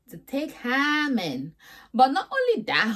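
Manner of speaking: ordinary talk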